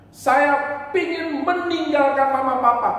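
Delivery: put-on high, loud childish declaration; defiant child. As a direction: announce it like a stubborn kid. (anak kecil yang membangkang, suara tinggi)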